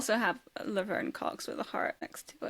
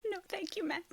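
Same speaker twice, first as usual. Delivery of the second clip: tiny high, soft imitation; meek refusal; 10 semitones higher, 8 dB quieter than usual. Co-host voicing the man declining her, mock meek. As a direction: shrink the voice up high and small.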